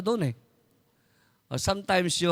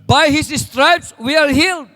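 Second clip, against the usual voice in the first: higher, louder, slow proclamation of the verse; emphatic proclamation; 6 semitones higher, 8 dB louder, slower than usual. Acted. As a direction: proclaim it as a triumph. Verse repeated with force. (malakas at mataas na pagpapahayag)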